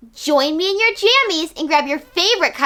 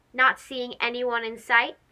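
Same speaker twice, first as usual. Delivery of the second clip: ordinary delivery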